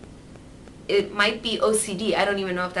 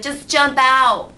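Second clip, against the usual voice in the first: mock yell; 6 semitones higher, 10 dB louder, slower than usual. Mock advice to tornado victim.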